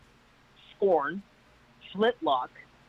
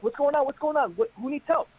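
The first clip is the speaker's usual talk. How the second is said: urgent questioning; 9 semitones higher than usual. Retold or imitated. Rescuer at the beach calling to the crowd, high and urgent. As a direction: demand answers from the crowd. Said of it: "I'm like".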